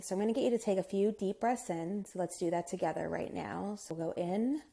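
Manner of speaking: soft, hushed meditation guide with unhurried pauses; guided meditation. Acted.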